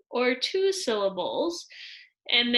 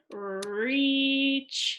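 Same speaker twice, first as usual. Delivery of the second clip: "reach" as one long, slow stretched syllable; long and drawn out; 3 semitones higher than usual. Shown as a demonstration. Teacher reading a syllable word to pupils, "reach" drawn out long. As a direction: hold the vowel of "reach" a long time. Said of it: "Even though that's kinda long".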